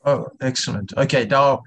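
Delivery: ordinary talk, nothing marked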